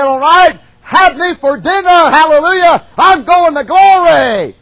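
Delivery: loud, high, triumphant cry of faith; joyful martyr. Retold or imitated. Preacher voicing martyrs facing lions, loud joyful cry. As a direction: shout it like a martyr glad to die. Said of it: "they said".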